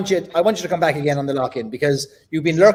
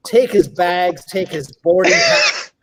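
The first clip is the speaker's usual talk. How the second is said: slow deliberate orders; slower than usual. Acted. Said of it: "slow emphatic instructions".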